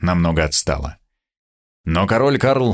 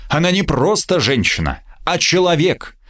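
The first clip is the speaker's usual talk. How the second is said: lofty, higher declamation; grandiloquent; 9 semitones higher than usual. Acted. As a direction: announce it like a noble truth. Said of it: "ответил я высокопарно". (высокопарно и возвышенно)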